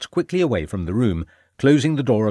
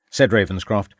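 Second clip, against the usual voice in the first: ordinary delivery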